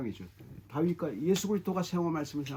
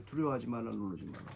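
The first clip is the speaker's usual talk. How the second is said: low, soft murmur to oneself; muttering scripture; 7 semitones lower, 6 dB quieter than usual. Shown as a demonstration. Preacher showing how to meditate on God's word, muttered low. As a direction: repeat it under the breath, dropping away. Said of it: "그걸 중얼거리는 거예요".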